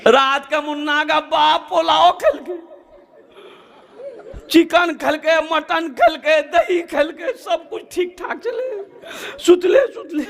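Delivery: high, hammed-up sobbing lament; mock funeral keening, breaking into sobs; wailing widow. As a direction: wail and sob through every phrase, comically. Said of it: "पीट पीट कर रो रही थी".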